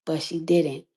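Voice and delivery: ordinary talk, nothing marked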